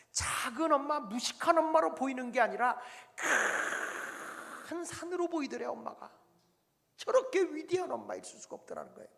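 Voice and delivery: fervent sermon climax; preaching at full force. Acted. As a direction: drive the point home from the pulpit.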